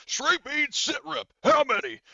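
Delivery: very high, loud soldier voice; Horgus switching on. Acted. Game master as soldier demanding a report, switched-on shout. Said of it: "Horgus kinda switches on".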